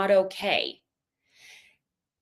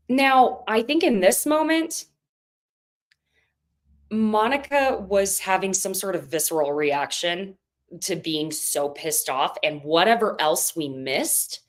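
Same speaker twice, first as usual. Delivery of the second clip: usual speaking voice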